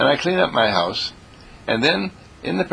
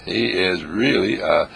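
ordinary talk, nothing marked

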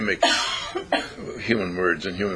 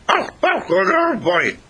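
high, loud, howled dog imitation; talking dog; 11 semitones higher, 4 dB louder, slower than usual. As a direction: yowl the words like a dog trying to speak. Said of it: "And the dog goes".

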